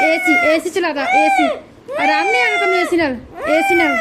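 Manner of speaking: real high-pitched loud coaxing, half exasperated; loud soothing. Real, not acted. Mother soothes screaming baby.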